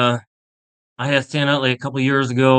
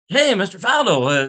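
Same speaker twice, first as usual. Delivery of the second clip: cheery greeting; 8 semitones higher than usual. Retold or imitated. Greeting Faldo at grocery.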